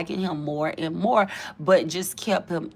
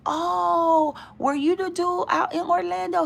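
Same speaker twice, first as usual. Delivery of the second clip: higher, surprised recognition; mock surprise; 11 semitones higher than usual. She recognizes the caller, higher mock surprise. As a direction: act out realizing who it is.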